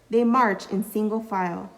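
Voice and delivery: ordinary delivery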